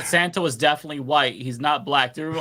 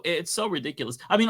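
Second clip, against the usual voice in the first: ordinary delivery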